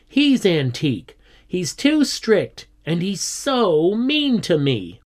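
high-pitched, aggrieved pupil; indignant complaint. A narrator's reading. Squiddy grumbling to friends about the teacher, indignant and high. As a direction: complain hard, leaning on each grievance.

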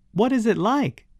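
high-pitched, eager question; breathless excitement. A narrator's reading. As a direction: ask in wide-eyed wonder, excited. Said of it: "almost breathless with excitement".

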